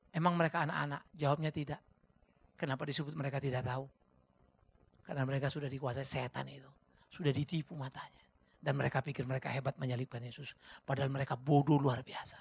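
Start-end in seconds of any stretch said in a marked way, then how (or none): none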